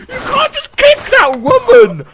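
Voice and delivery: high-pitched indignant protest; high, stretched-out disbelieving protest; outraged disbelief. Real, not acted. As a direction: squeal your outrage at the bully. Outraged at the game husband.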